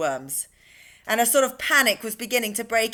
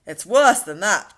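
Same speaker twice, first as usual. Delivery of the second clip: louder alarmed cry; cried out; 7 dB louder than usual. A narrator's reading. Police chief crying out to his men on the rooftop. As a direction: call it out in alarm. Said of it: "cried the chief of police".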